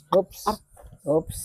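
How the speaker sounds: ordinary talk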